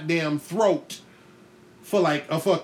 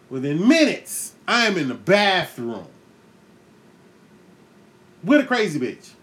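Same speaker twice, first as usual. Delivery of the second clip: ordinary talk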